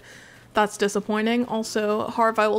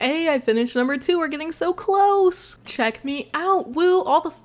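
excited; 6 semitones higher than usual. Real, not acted. Celebrating progress.

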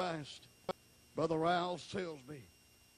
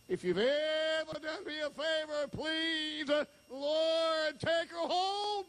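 desperate plea; 13 semitones higher, slower than usual. Retold or imitated. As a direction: beg God with everything you have. Retold desperate prayer to Lord.

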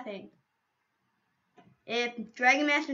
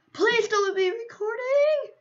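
mock whine; 9 semitones higher than usual. Begging the stream not to record.

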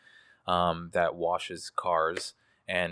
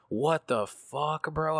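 slow, higher-pitched retold indignation; heated; 7 semitones higher, much slower than usual. Worker replays his reaction to the customer, heated. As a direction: stretch it out in heated disbelief. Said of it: "I was heated".

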